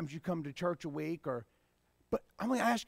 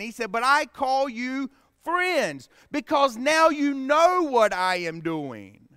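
raised, emphatic sermon delivery; forceful preaching; 3 semitones higher, 5 dB louder than usual. Real, not acted. Preacher contrasts slaves with friends of the king, forcefully. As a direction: drive the key words home to the congregation.

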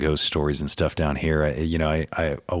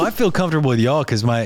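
usual speaking voice

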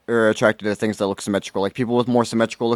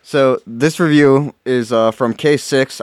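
slow dramatic; 2 semitones higher, 3 dB louder, much slower than usual. Pretend. Slow dramatic review read.

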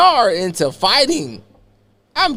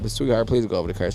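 low, soft, fast sweet-talk; smooth player; 8 semitones lower, 9 dB quieter, faster than usual. Retold or imitated. Sweet-talking the driver into jumping the curb, smooth low. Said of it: "Hey, I'm a player".

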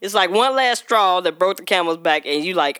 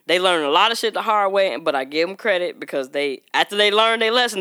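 normal talking voice

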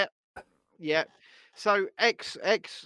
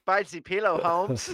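put-on Mexican accent; 5 dB louder than usual. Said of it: "in a Mexican accent".

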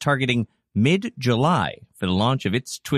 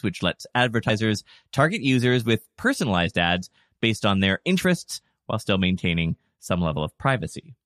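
usual speaking voice

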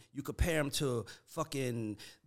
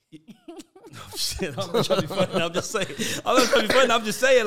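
talking through real laughter, louder; laughing while insisting it's not a joke; 7 semitones higher, 10 dB louder than usual. Real, not acted. Laughs while defending his point.

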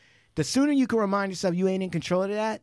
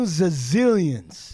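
very slow, dragged-out zazillions; stretched zazillions; much slower than usual. Real, not acted. Insisting on the lawyer's fortune, very slow dragged word. Repeated, even slower.

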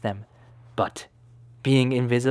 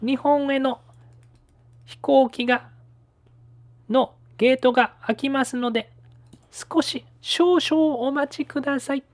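high announcer voice; 13 semitones higher than usual. Acted. Airport announcement in Japanese.

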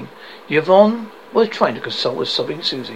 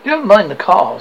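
louder, a hint of tearful pleading; lightly voiced crying wife; mild sob; 2 semitones higher, 8 dB louder than usual. Wife on the phone to husband, lightly sobbing. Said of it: "Susie sobbed".

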